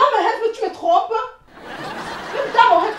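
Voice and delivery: high, loud outrage; angry accusation. Acted. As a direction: shout it as a jealous accusation. Accuses partner of cheating.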